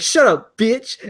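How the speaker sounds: high, loud mock outburst; imitated rage. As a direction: snap it like a raging streamer. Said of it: "next thing he rages".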